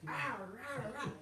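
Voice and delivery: high-pitched wordless griping; mock fussing. Himself complaining to God, high mock fussing. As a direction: imitate your own whiny complaining. Acts out own past fussing.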